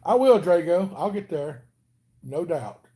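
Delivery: ordinary talk, nothing marked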